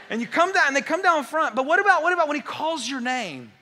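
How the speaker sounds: loud, high, fast preaching; full-force excited sermon; fervent preaching. Acted. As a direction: preach it out with rising joy.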